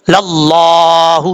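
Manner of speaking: للہ with a full, heavy lam; heavy Allah. Shown as a demonstration. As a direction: chant للہ slowly with a thick lam. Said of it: "لفظ اللہ پر پڑھا جاتا ہے". (موٹا لام، آہستہ تلاوت)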